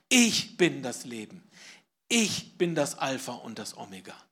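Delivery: laut ausgerufen, „Ich“ kräftig betont; laut verkündet. Shown as a demonstration. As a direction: ruf es der Gemeinde mit voller Kraft zu. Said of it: "Das ist eine zweifache Ich-Betonung". (laut, das „Ich“ stark betont)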